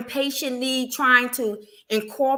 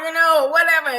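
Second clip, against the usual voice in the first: usual speaking voice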